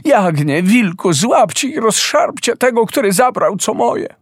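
calling out high, rising in pitch; frantic high command to the lamb and wolf; desperate call. A narrator's reading. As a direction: summon the beasts in despair. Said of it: "zrozpaczona Piękna zawołała".

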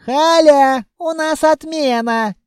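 high-pitched holler to another room; loud yell. A narrator's reading. Clerk calls Khalya about a cancellation, loud high yell. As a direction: yell it out at full voice. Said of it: "повернулась и громко заорала".

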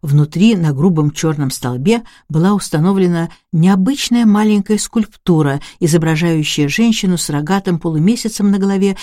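plain reading voice

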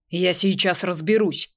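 plain reading voice

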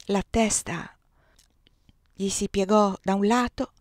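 ordinary delivery

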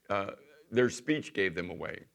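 ordinary delivery

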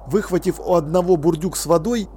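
plain reading voice

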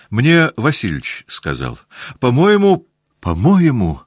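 по-моему cried out high and loud; near shout. A narrator's reading. Natasha cries out at Petya, almost shouting. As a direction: burst out, almost screaming. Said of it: "вдруг закричала почти Наташа".

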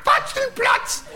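put-on very high, loud kid screech; child shout. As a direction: scream the insult like a little boy. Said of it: "hat er gesagt zu mir". (kreischendes Kind)

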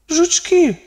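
дуже високий внутрішній голос; застережи подумки тонким голосом; думка до друга. A narrator's reading. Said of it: "подумала вона для Нікі". (високий застережливий голос думки)

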